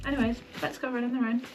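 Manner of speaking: normal talking voice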